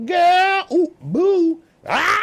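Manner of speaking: very high, loud falsetto grandma voice; Madea scare. Retold or imitated. Mocking Madea's scare from the movie, shrieking falsetto. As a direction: spook them like Madea jumping from the closet. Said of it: "Madea, boo".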